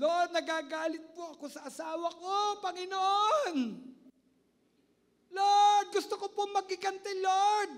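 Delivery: a troubled believer begging God for help; pleading prayer. Acted. Believer kneeling, praying to the Lord about his anger, pleading. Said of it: "lumuhod ka sa Panginoon".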